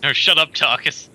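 loud snap; shouting. Pretend. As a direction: yell it back. Yelling at the character.

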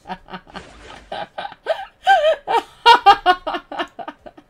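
a high, burst-out belly laugh at herself; loud real laugh. Real, not acted. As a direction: crack up loudly, can't hold it. Hearty laugh at own mistake. (carcajada fuerte y aguda)

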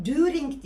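"During" as "dü-rink" with a hard k ending; wrong during, ü vowel. Shown as a demonstration. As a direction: say "During" with Turkish ü and final k. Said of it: "İkisi de değil".

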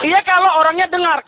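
ordinary talk, nothing marked

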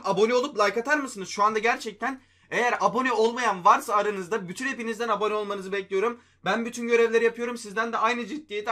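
high, loud excited appeal; thrilled, loud call to subscribe. Real, not acted. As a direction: stay pumped and loud. Excited after the drop.

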